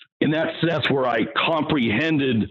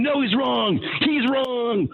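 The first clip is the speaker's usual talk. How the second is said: put-on higher, smug kids' chant; mocking smug kids; 8 semitones higher, slower than usual. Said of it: "They went".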